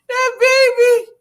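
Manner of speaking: high, loud, drawn-out laughing cry; real amused squeal. Real, not acted. Host cracking up over the baby picture, squealing.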